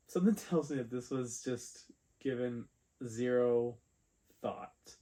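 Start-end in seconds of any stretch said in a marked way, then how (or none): none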